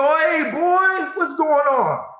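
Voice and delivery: high, loud casual greeting; imitating his father. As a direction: play the dad acting like nothing happened. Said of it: "And he go".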